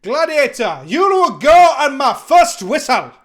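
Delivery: loud, slow Scottish arena announcer; Scottish accent. Acted. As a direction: call the gladiators in a Scottish boom. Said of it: "one of the few accents I'm allowed to do".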